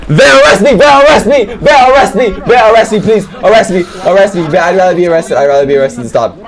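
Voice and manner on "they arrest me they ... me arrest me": high, loud, repeated begging; frantic pleading. Real, not acted. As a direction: beg them to arrest you. Begging in game chaos.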